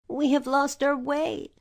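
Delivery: a hint of a worried girl; Dorothy. A narrator's reading. Dorothy voiced.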